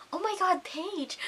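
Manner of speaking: high, hushed mock astonishment; barely-voiced, high-pitched disbelief; shocked. Friend reacts to Paige's boldness, hushed shock. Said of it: "I was just so shocked".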